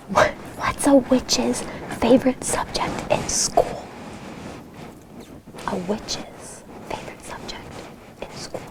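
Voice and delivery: slow fading whisper. Acted. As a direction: whisper it, almost silent.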